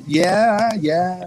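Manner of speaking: limp, awkward half-hearted yeah; deflate, barely mean it; meek half-hearted. Retold or imitated. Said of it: "And then I'm kinda just".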